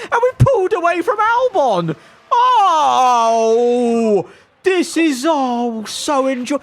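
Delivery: high, choked-up happy cry; nearly crying with delight; overjoyed voice cracking into tears; tearful joy. Real, not acted. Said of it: "I think I am tearing up a bit".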